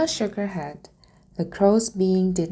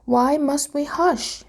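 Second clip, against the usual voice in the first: high, slow puzzled question; curious dragon; 9 semitones higher than usual. A narrator's reading. Dragon asks Luna why, high and slow. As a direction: ask innocently, drawn out. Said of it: "Firion asked".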